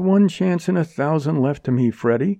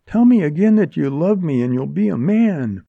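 higher-pitched, sweeping appeal; urgent coaxing; 9 semitones higher, 3 dB louder than usual. A narrator's reading. Woman begging Freddy to fight for her, urgently coaxing. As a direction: press him eagerly, carrying him along. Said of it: "trying to sweep him along with her".

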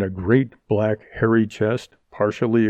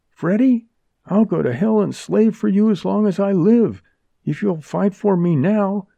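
higher-pitched, sweeping appeal; urgent coaxing; 9 semitones higher, 3 dB louder than usual. A narrator's reading. Woman begging Freddy to fight for her, urgently coaxing. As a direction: press him eagerly, carrying him along. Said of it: "trying to sweep him along with her".

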